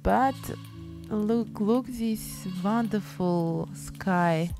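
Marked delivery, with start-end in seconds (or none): none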